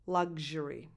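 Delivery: luxury said LUG-zhuh-ree, voiced gzh. Shown as a demonstration. Coach gives her own way of saying luxury with gzh. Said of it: "that's how I pronounce it".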